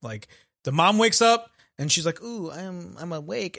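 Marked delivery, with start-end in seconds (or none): 2.2-3.6 groggy. Acted. Mom just waking up, groggy.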